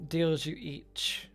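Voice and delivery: normal talking voice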